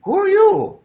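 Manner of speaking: high, loud astonished cry. Acted. Woman startled by a man from a star, astonished. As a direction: blurt it out in shock.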